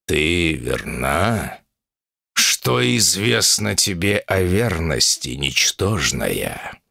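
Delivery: deep, slow threatening growl; menacing bull demon. Acted.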